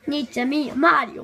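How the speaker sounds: ordinary talk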